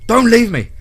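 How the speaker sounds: pleading, building to a high loud scream; terrified scream. A narrator's reading. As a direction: scream in fear of being abandoned. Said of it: "Brady screamed".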